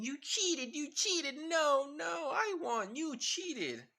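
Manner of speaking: put-on high, loud whiny kid protest; a young boy's high, loud tantrum over losing; childish tantrum. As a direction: whine it like a sore loser kid. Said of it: "having a bit of a tantrum when they were younger".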